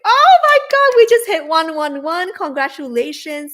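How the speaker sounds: high, loud squeal of joy; real excitement. Real, not acted. Host celebrates with viewers the moment the goal is hit, squealing. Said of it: "celebrating milestone live".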